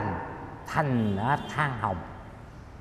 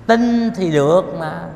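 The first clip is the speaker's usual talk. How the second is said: louder, higher, pressing the point home; emphatic insistence; 5 semitones higher, 8 dB louder than usual. Real, not acted. Monk urging the lay listeners to believe, emphatic. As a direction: insist it just works if you believe.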